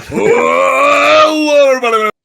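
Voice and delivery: big hammy villain voice, shouted end; Colossus. Retold or imitated. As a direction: boom out like a comic book brute. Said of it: "you sound like Colossus from the X-Men".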